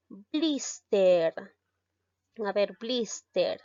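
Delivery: "blister" as BLIS-ter, drawn out; slow demo of "blister", weight on its first syllable; stress on first syllable. Shown as a demonstration. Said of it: "La mayor fuerza de voz recae en la sílaba".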